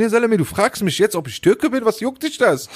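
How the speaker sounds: high, incredulous mock rant; mock outrage. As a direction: act out being outraged at the cashier. Outraged inner reply to cashier. (spielt empörte Gedanken gegenüber dem Kassierer, hoch und ungläubig)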